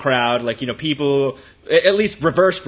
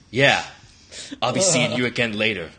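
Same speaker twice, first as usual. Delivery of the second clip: low, halting mock menace that sounds seductive; would-be threatening; 5 semitones lower than usual. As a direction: imitate a failed threat, low and suggestive. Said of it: "trying to be threatening".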